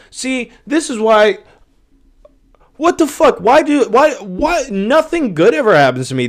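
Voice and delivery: high, loud, indignant disbelief; whiny high-pitched outrage at missing out; outraged rant. Real, not acted. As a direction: rant like you've been robbed. Outraged rant about lost money.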